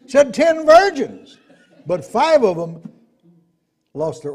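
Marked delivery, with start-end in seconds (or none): 0.1-1.4 high, loud pulpit cry; forceful preaching. Real, not acted. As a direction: hammer it home to the congregation.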